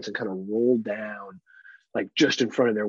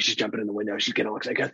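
usual speaking voice

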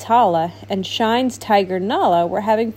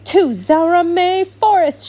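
commanding; 8 semitones higher, 5 dB louder, slower than usual. Acted. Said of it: "Shine commanded".

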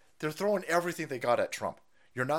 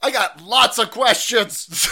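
high, loud, slow mocking voice; mock reporter; 7 semitones higher, 11 dB louder, slower than usual. Mocking the reporter with prepared questions, high loud slow taunt. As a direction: sneer it like a nagging reporter.

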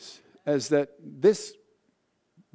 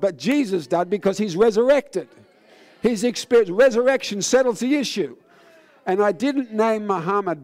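loud, high, driving sermon delivery; raised preacher's voice building to a peak; fiery preaching; 5 semitones higher, 5 dB louder than usual. Acted. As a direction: preach it out to the crowd at full force. Preaching at full force.

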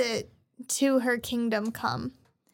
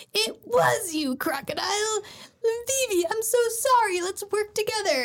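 dramatic Koza; 8 semitones higher than usual. Retold or imitated. Koza voiced in retelling.

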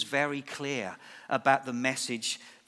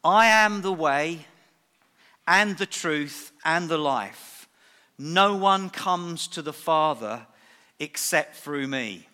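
slow, each phrase stressed, "I" hit loud; deliberate, emphatic scripture quote; 4 dB louder than usual. Retold or imitated. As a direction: lay every word down slowly with weight. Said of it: "Jesus said these words".

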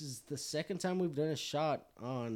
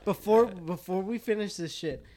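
raised voice to get attention; loud; 7 semitones higher, 12 dB louder than usual. Real, not acted. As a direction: call over the group.

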